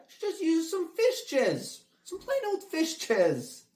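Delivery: drawn-out, very high punchline voice; high-pitched joking. Acted. Comic defending a celebrity's skincare, high and drawn out. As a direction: pitch it way up and stretch it out, playfully.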